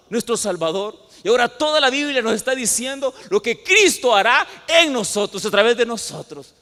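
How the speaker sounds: loud, high fiery sermon voice; full-force preaching. Acted. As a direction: proclaim it to the whole church at full volume.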